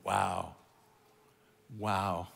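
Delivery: low soft awe; quiet, low amazement; hushed wow. Real, not acted. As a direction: breathe it out in wonder.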